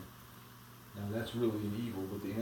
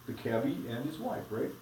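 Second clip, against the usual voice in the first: ordinary delivery, nothing marked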